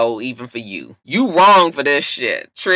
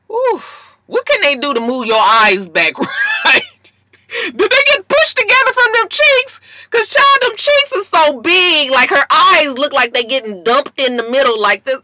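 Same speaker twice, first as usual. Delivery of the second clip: much higher and louder, squealing outrage; shrieking disbelief; 12 semitones higher, 4 dB louder than usual. Real, not acted. To viewers, squealing over a strange face. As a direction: shriek it with gleeful horror. Ranting about Nikki's face.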